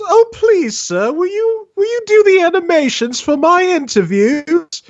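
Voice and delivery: high hammed-up British plea; terrible British accent. Retelling how Retro begged for animations, fake British accent. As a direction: beg in an overdone fake British voice. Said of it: "my terrible impersonation".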